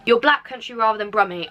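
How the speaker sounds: ordinary talk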